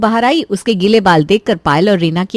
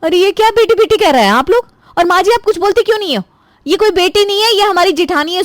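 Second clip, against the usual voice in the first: shocked outburst; 9 semitones higher than usual. Acted. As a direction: cry out in disbelief at the mix-up. Shocked sister-in-law objects loudly. (ऊँची चौंकी हुई आवाज़ में विरोध)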